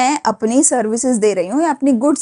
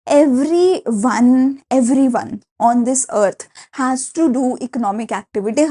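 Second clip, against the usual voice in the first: slow emphatic dictation; much slower than usual. Acted. Teacher stressing a key sentence.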